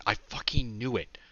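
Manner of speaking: hushed unvoiced exclamation; whisper. A narrator's reading. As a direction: whisper it under your breath. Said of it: "avoid saying".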